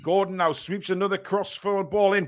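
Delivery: ordinary delivery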